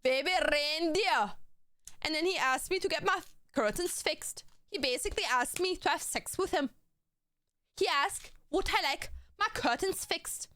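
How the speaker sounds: high, hammed-up Scottish accent; playful exaggerated Scottish lilt; mock Scottish accent. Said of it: "it was Scottish".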